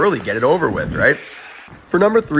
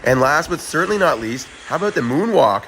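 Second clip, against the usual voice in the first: ordinary delivery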